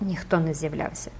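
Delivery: usual speaking voice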